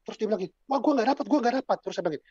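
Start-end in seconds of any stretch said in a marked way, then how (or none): 0.7-1.8 high-pitched imitation of a fretting teen; mock complaint. Teen girl tells counselor she got nobody, high mock complaint. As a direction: play a teen protesting in a raised pitch.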